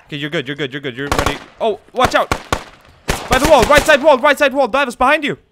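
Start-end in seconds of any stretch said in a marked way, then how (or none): 1.6-5.4 high, loud alarmed callouts; urgent warning shout. Real, not acted. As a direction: yell the warning. Warning teammates under attack.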